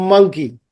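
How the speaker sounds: M held at the start of "monkey"; nasal M in "monkey". Shown as a demonstration. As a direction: hum into the M of "monkey". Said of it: "সাউন্ডটা নাক দিয়ে বেরোচ্ছে".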